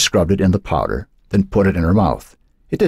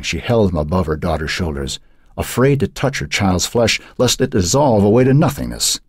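ordinary delivery

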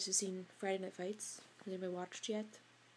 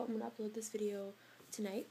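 ordinary talk, nothing marked

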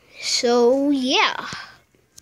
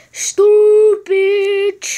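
much higher, louder yell; frustrated shout; 9 semitones higher, 10 dB louder than usual. Real, not acted. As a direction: yell it at the screen. Frustrated at game.

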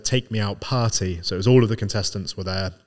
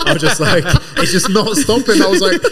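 laughing; 11 semitones higher, 8 dB louder than usual. Real, not acted. Laughing while retelling the embarrassment.

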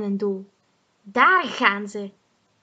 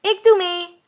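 high, loud eager call; 8 semitones higher, 7 dB louder than usual. Acted. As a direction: call out keenly to join. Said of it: "roept Mie".

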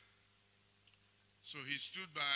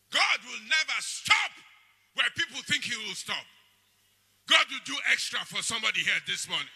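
preaching at full force; 6 semitones higher, 10 dB louder than usual. Acted. Shouted declarations to church.

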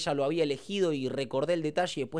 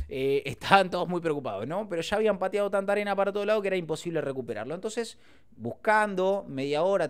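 ordinary delivery

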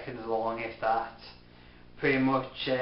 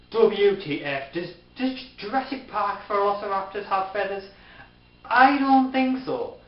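indignant disbelief; 7 semitones higher, 5 dB louder than usual. Real, not acted. Outraged at feathered velociraptors.

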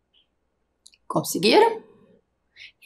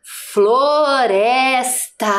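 FLORESTA stretched out very slowly, every syllable held long; leitura silabada; much slower than usual. Shown as a demonstration. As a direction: read FLORESTA to children, drawing out each syllable. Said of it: "Escrevemos o que mesmo?". (Floresta bem devagar, esticando cada sílaba)

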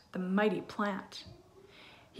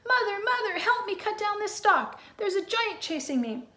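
much higher, frantic cries; panicked boy; 11 semitones higher, 3 dB louder than usual. Acted. Jack calling his mother for help against the giant, panicked.

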